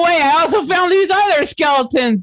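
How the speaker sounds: high, squeaky offhand announcement; casual creature voice. Acted. Toad Wizard mentioning the skeletons he found, high squeaky offhand. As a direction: drop the news lightly in a cartoonish squeak.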